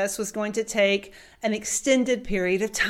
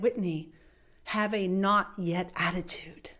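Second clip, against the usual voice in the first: whispered, gentle wisdom; hushed advice; 4 semitones lower, 5 dB quieter than usual. Retold or imitated. Father whispering advice to his daughter on the veranda. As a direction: pass on a secret softly.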